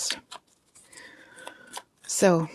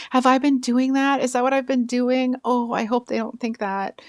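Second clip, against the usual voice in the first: high-pitched put-on worried voice; mock fretting; 7 semitones higher than usual. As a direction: imitate someone fretting over their reputation. Mimicking rival fretting to others.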